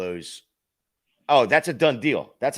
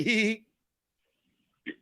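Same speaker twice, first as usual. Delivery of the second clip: angry laughter; 12 semitones higher than usual. Retold or imitated. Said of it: "the guy's like".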